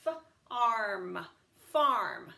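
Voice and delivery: f- arm, then farm blended; f-, arm, farm sounded then blended; final consonant drill. Shown as a demonstration. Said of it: "cover this and say".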